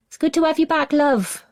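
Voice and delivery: high, gentle motherly voice; mum's voice. A narrator's reading. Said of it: "his mum's voice trails after him".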